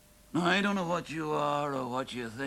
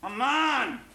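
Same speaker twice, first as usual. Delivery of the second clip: higher, louder swaggering brag; drunken boast; 6 semitones higher, 4 dB louder than usual. Acted. Drunk husband bragging on the stairs, loud and swaggering. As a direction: puff yourself up, drunk and defiant.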